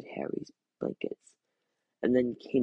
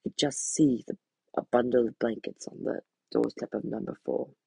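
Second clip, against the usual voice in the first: usual speaking voice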